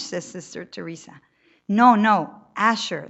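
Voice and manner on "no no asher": correcting insistently. A narrator's reading. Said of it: "as if warning the nun".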